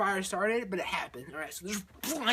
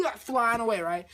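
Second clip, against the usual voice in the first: ordinary delivery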